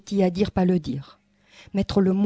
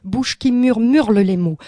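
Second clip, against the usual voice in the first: normal talking voice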